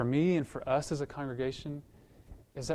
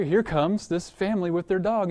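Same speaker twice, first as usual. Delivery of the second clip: ordinary talk, nothing marked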